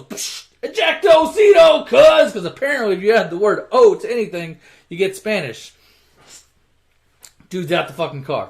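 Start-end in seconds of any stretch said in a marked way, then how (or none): 0.0-2.3 high, slow hammed-up shout. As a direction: yell it like a goofy action-movie battle cry. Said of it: "So [name] goes".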